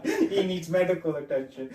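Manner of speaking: lightly voiced repeat, laughing; a hint of the deadpan cop; laughing mock-serious delivery; imitating the line again. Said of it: "funny how he said that line".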